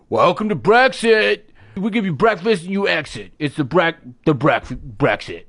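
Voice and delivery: put-on restaurant greeter, sinking in pitch; hipster breakfast host. Hipster breakfast place greeting customers, put-on host voice. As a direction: welcome diners, then stumble over the name. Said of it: "new hipster breakfast place down the street".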